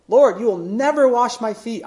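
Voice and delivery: an absolute no, never hit firmly; never pushed out as a flat refusal; emphatic refusal. Retold or imitated. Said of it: "It's an absolute negative statement".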